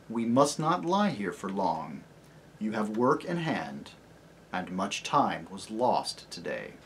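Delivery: low, soft and deep lion; gentle great lion greeting a child; low, tender lion voice; lion's voice. Acted. Aslan voiced.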